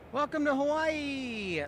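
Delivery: high, slow showy announcer greeting; hammed-up high welcome to the islands. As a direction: sing it out like a tour host. Mock announcer greeting to stream.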